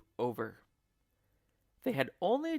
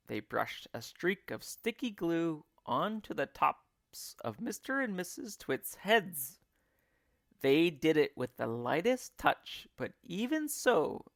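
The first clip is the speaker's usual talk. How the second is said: plain reading voice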